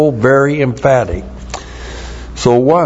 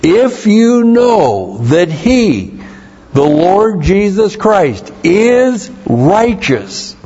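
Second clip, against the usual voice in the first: higher and slow, a solemn reading voice; reading scripture aloud; 6 semitones higher, slower than usual. Acted. Preacher reading John's words to the congregation, raised and slow. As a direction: lift the voice and slow down for the verse. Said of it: "John is saying here".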